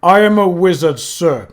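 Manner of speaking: mock the wizard's words; sneering Death. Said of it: "Death sneered".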